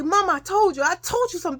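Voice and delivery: high, plaintive complaint; reenacted upset pleading. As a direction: plead like a child vindicated to a parent. Upset child pleads to mother.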